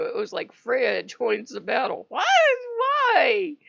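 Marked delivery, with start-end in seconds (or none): 2.1-3.6 exasperated why. Real, not acted. Exasperated cry.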